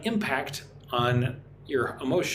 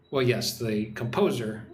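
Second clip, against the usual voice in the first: ordinary delivery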